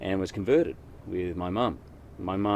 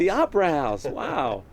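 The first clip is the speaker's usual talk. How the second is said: high, loud gushing American tourist; American accent; 9 semitones higher, 6 dB louder than usual. Pretend. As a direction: exclaim it like a dazzled American visitor. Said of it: "thought I was from America".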